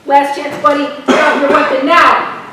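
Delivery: loud, firm command; officer yelling drop it; shouted last warning. Acted. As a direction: bark the last chance. Cop's final command again.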